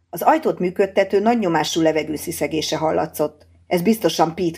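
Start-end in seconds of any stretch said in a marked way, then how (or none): none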